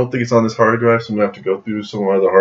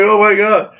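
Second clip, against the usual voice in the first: high, giddy retold amazement; starstruck; 13 semitones higher, 3 dB louder than usual. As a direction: re-enact a fan's thrilled gasp of words. Retold starstruck reaction to actor.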